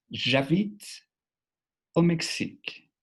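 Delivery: "J'habite au Mexique" said high and careful in French; French model phrase. Shown as a demonstration. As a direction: demo "J'habite au Mexique" with silent final e. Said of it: "Es una de las primeras formas de decirlo".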